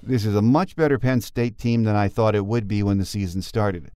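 ordinary delivery

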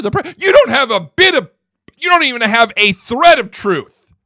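real fury, voice rising and loud; angry rant. Real, not acted. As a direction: let the anger boil over into shouting. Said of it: "Now, that irritates me".

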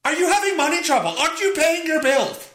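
high, drawn-out, sing-song nagging mother; nagging mom. Retold or imitated. Mother phoning her son about unpaid bills, high sing-song nag. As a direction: whine it like a worried mom scolding. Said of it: "I'm gonna get a phone call".

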